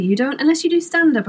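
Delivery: ordinary talk, nothing marked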